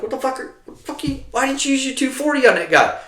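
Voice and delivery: high, demanding questioning; incredulous shout. Retold or imitated. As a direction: challenge him hard. Questioning gunner.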